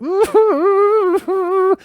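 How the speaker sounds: high whimpering squeal of trapped laughter; long, high, strangled giggle; stifled tearful laugh. Retold or imitated. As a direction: imitate laughter leaking out as crying. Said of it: "trying not to laugh".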